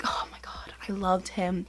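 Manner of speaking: soft breathy confession in a whisper; swooning whisper. Real, not acted. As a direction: whisper it like a secret crush. Swooning over childhood crush.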